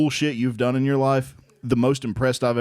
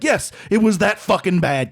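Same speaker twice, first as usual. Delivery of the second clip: higher, exasperated insistence; level ten upset; 7 semitones higher, 3 dB louder than usual. Retold or imitated. Husband on the phone contradicting his wife, imitated heated exasperation. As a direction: insist hotly, pitch jumping up. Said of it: "at a level ten upset".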